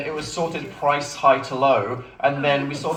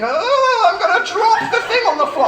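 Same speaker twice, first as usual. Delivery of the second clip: high, fast, laughing flustered grandma voice; panicked grandma; 6 dB louder than usual. Retold or imitated. Grandma nearly dropping the bin, squealing in a high panic. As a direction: squeal it like a flustered old lady near dropping things.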